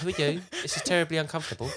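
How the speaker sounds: high-pitched, put-on fussy voice, laughing; awkward spirit. Podcaster joking as a crowded spirit, high and laughing.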